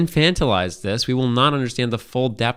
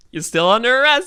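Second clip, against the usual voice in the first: high, louder mock cop voice; 10 semitones higher, 4 dB louder than usual. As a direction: announce the arrest like a smug cop. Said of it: "he's like".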